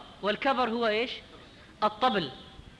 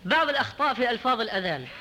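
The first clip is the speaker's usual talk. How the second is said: ordinary talk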